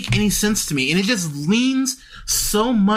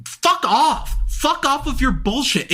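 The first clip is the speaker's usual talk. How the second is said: higher, sharp angry brush-off; angry fuck off; 7 semitones higher, slower than usual. Real, not acted. Telling fans to get lost, angry and raised. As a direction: tell them off, fed up.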